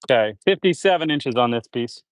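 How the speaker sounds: usual speaking voice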